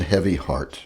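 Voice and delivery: usual speaking voice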